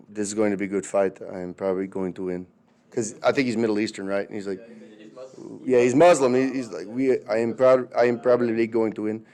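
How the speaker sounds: low, soft, calm imitation of Khabib; understated quiet confidence; real soft. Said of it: "he talks, like, real soft".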